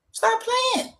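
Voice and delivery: very high falsetto jab; squeaky, incredulous dismissal; teasing rebuke. Real, not acted. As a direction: squeal it as a playful scolding. Teasing rebuke after listing families.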